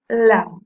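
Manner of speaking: lam said alone, slow and loud; stressed syllable alone. Shown as a demonstration. Teacher pointing out the strong syllable, lam stressed on its own. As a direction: isolate lam and hit it firmly. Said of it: "que suena mucho más fuerte".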